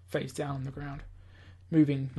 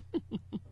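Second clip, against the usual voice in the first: short evil chuckle; 5 semitones higher, 8 dB quieter than usual. Acted. Villain gloating to himself.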